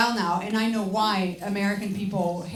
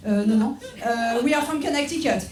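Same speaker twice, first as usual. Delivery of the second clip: higher-pitched, hammed-up French accent; exaggerated French accent; 4 semitones higher than usual. French tourists claiming to be from Connecticut, exaggerated French accent. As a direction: badly pass as American in thick French accent. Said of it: "pretend to be from somewhere else".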